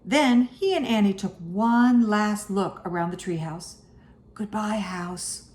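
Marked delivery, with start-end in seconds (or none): 4.3-5.5 soft, slow, hushed goodbye; hushed storybook farewell; whispered. A narrator's reading. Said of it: "whispered Annie".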